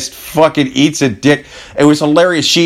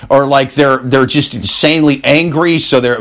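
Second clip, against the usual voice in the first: ordinary talk, nothing marked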